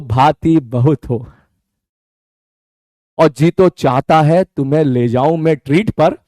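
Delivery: slow teasing poem recital; playful shayari. Acted. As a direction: recite the couplet slowly, with a wink.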